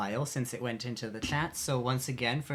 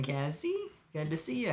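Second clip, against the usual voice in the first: normal talking voice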